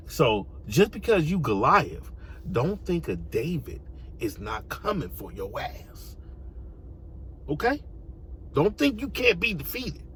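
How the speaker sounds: ordinary delivery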